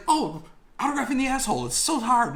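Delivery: put-on overdone high British accent; bad British accent. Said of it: "I'm gonna fuck up a British accent here".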